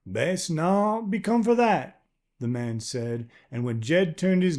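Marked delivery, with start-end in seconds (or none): 0.1-2.0 put-on higher, rough old man with a near-Southern accent; a wary stranger's unplaceable accent; strange rural accent. Man voiced by narrator.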